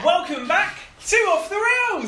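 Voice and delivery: announcer shout. Acted. Host welcomes listeners back to the show, announcer shout. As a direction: hype the crowd like a show host. Host opens second half.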